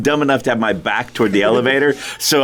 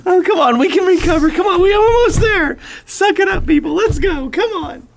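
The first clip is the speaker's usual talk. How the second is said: high-pitched mock pep talk; rally the group in a high, urgent voice.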